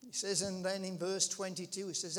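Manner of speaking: ordinary delivery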